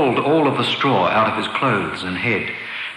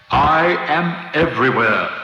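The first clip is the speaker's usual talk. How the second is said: mysterious Oz voice; 5 semitones higher than usual. A narrator's reading. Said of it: "answered the voice".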